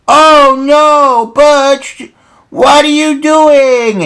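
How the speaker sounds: high-pitched, loud cartoon dismay; Cool Cat. Cool Cat catching the bully at the computer, high loud alarm. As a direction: cry out in a high put-on voice, drawing out the end.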